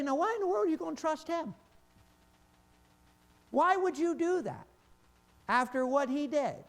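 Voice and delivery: mock the skeptics in disbelief; incredulous doubters. Mocking doubters questioning trust in Peter.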